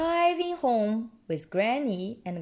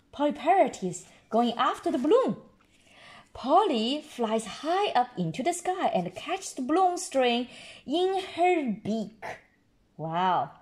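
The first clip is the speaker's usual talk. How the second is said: ordinary delivery, nothing marked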